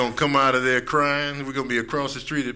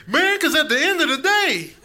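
friend misusing sayings; 11 semitones higher, 4 dB louder than usual. Retold or imitated. Friend borrowing a dollar, in a high goofy voice. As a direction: play the friend who mangles idioms, pitched way up. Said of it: "he'd be like".

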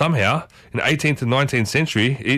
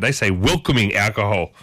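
ordinary delivery

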